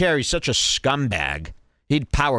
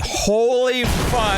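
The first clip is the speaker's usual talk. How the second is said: slow, high, drawn-out disbelief; stunned; 11 semitones higher, much slower than usual. Real, not acted. Commentator reacting to the clip, slow and stunned. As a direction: stretch it out in shock.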